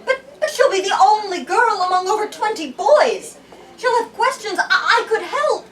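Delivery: high, loud, stammering plea; desperate pleading. Acted. Alana pleads to help the new girl, high and loud. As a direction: beg the king, voice climbing. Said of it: "cried Alana".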